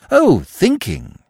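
slow, high, awed tone; impressed. A narrator's reading. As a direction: say it with wide-eyed respect.

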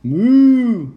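loud, long moo; cow moo. Acted. Cow with its own sound back, loud high moo. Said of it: "said the cow".

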